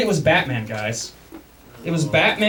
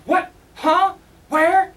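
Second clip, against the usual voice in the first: confused boss; 11 semitones higher, much slower than usual. Retold or imitated. Boss finds him gone.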